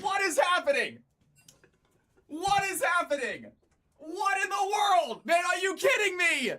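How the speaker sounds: can't believe what just happened, pitch way up; stunned disbelief. Real, not acted. Streamer in shock at the game, high shouting disbelief.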